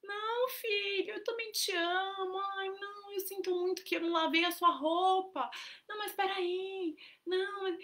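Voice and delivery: put-on high, pleading kid voice; mimicking a clingy son; child's whiny voice. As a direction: whine like a guilty-tripping child. Mother voicing child's complaint.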